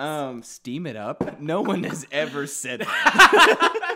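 high, loud and slow, laughing through it; goofy slow put-on voice. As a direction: drag it out in a silly voice. Silly sauna skit.

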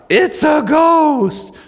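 a long, very high, drawn-out shout of terror; terrified scream. Acted. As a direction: scream it like panicked sailors seeing a ghost. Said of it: "and they scream out".

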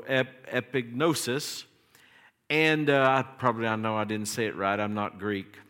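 ordinary talk, nothing marked

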